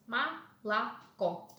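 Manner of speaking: "малако" said ma-la-KO, syllable by syllable; first two vowels of "малако" as clear A; unstressed O as A. Shown as a demonstration. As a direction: break "малако" into ma, la, KO. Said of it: "is pronounced as".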